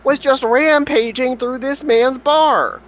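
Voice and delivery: high, pleading character voice; nervous apology. Acted. Adventurer pleading with the angry farmer, high and flustered. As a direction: beg forgiveness in a squeaky panic.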